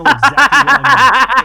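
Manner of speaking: slow, loud laughing protest. Acted. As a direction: laugh it out while insisting. Defending himself, laughing.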